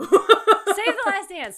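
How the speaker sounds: high, slow, loud laughing shriek. Real, not acted. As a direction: shriek the title through laughter. Laughing outburst naming a bad movie.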